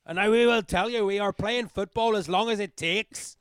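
mock Northern Irish party speech. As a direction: declare it like a Northern Irish politician. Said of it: "some kind of Northern Irish".

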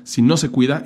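ordinary delivery

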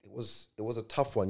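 ordinary talk

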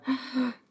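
sharp in-breath of horror; a short shocked gasp. Retold or imitated. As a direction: gasp as if hearing the news. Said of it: "gasping moment of".

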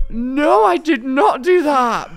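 mock horrified protest; horrified denial. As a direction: insist loudly it wasn't you. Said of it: "And I was like".

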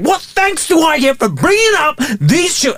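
high-pitched melodramatic rant; soap opera tirade. Acted. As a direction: pour out grievances, high and overwrought. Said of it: "soap opera way".

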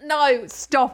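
usual speaking voice

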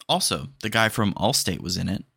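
ordinary talk, nothing marked

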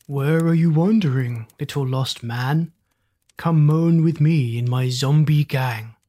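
zombie voice. Acted. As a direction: groan it out like a hungry zombie. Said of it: "A zombie saw him".

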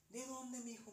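a hint of a puzzled old lady; old grandmother. Grandmother voiced in ghost story.

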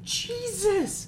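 squeaky high exclamation; high mock surprised yelp; mock shock. Real, not acted. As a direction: yelp in fake amazement. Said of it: "mock shock at farm size".

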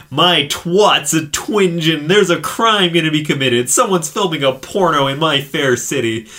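camp it up like a cheap superhero; hammed-up heroine. Superheroine announces a crime from her couch, hammed-up and loud. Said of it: "she's just like".

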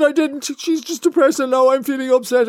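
high, loud, half-laughing whimper; mock tearful protest. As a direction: pretend to be upset too. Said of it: "And I said".

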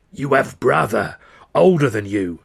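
put-on an old seer calling out her vision; crone's shriek. A narrator's reading. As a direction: cry out loudly, weighting each word. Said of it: "she shrieks out".

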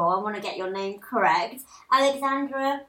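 ordinary talk

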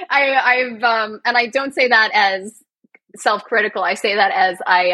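ordinary talk

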